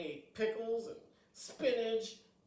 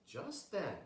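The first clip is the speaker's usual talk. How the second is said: plain reading voice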